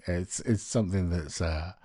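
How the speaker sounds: ordinary delivery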